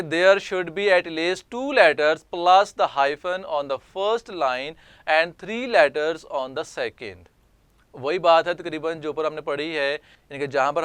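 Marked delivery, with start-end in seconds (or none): 0.0-7.3 slow, deliberate dictation reading; careful classroom reading voice; slow read-aloud. Shown as a demonstration. Teacher reads rule text aloud.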